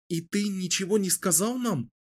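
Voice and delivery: high, reproachful disbelief; stunned she wasn't told; astonished. Acted.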